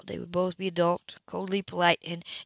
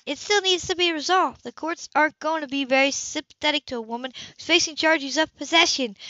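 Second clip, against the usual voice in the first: higher, controlled and insistent; firm older sister; 8 semitones higher, 5 dB louder than usual. Acted. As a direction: lay out the terms steadily. Sybil's voice, pitched higher.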